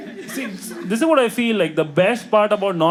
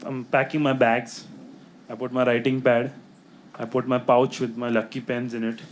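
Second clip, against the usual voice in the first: usual speaking voice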